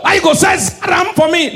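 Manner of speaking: high angry yell; high angry shout. Retold or imitated. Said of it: "Igbo call the prophet".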